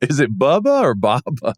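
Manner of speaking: Bubba with short u, then Baba with open ah vowels; Bubba versus Baba. Shown as a demonstration. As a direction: set Bubba against Baba. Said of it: "Bubba sounds a little different".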